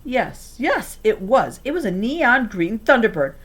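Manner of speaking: usual speaking voice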